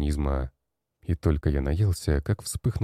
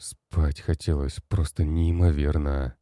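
usual speaking voice

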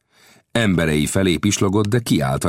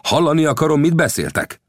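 rude snap; 11 semitones higher than usual. A narrator's reading. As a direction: bark it at them roughly. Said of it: "gorombán".

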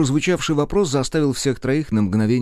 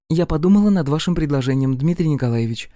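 higher, lilting sweet voice; sweet chirping; 6 semitones higher than usual. A narrator's reading. Katerina teasing Nikitin by charming Rudnev, sweet chirp. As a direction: chirp it to make a suitor jealous. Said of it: "мило прощебетала Катерина".

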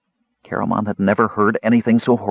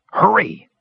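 high, strained urging; high-pitched desperate plea; urgent gasp; 10 semitones higher than usual. A narrator's reading. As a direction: urge them on under heavy strain. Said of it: "he gasped".

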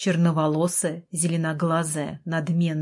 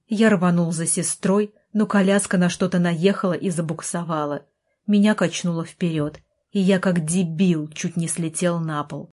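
usual speaking voice

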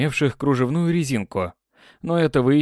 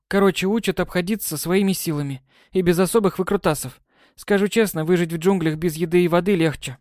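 put-on complaining girl; 6 semitones higher than usual. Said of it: "Тяжело вздохнув, пожаловалась".

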